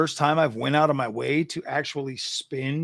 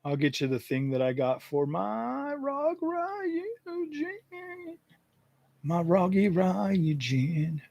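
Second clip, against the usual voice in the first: much higher, slow and softer, cutesy; playful high sing-song; silly high voice; 4 semitones higher, 6 dB quieter, much slower than usual. Pretend. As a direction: coo over the cooler like a pet. Playful aside while fetching link.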